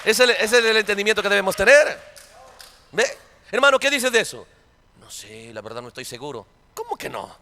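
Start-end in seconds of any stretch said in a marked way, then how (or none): 5.0-6.4 much lower and very soft, mumbling doubt; mock unsure brother. Preacher mimicking a doubting brother, low and hushed. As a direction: mimic a hesitant brother under your breath.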